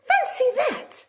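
high delighted exclamation; surprised. Acted. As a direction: gasp it out in wonder. Playful exclamation in story.

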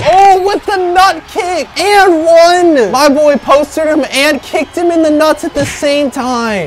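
high, stretched-out exclamation; excited play reaction. Real, not acted. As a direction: hype up a wild highlight. Reacting to dunk with kick.

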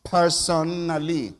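"personally" stretched slowly, syllable by syllable; "personally" said very slowly and deliberately; emphatic, drawn-out word. Shown as a demonstration. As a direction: draw out "personally" to bring out the person in it. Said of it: "when I say knowing God".

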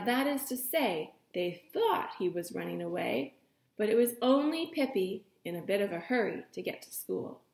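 ordinary delivery

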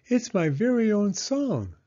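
put-on high, eager child voice; a small girl begging her mother to listen; little girl Penny. Little Penny asks Mama to listen, high and eager.

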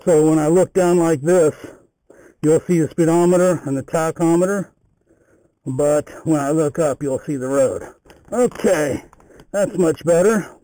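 muffled Bluetooth helmet mic; speaking inside a closed helmet. Real, not acted. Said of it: "I'm gonna be wearing a enclosed helmet".

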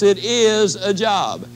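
slow, weighted it is a job, each word leaned on; stressed slowly. Shown as a demonstration. Speaker pressing the point to the woman, slow and stressed. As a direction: lay it out word by word. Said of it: "But let me stress".

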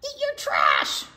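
high loud gleeful yell; gleeful shout. Acted. Reading to kids, the truck shouts its secret. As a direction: shout it with monster glee.

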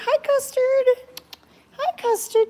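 high, loud coo. Real, not acted. Woman greeting her cat Custard in a high baby voice. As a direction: sing the cat's name up high.

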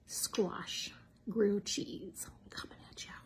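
slow soft whisper. Real, not acted. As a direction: whisper it slowly. Whispering while showing the food.

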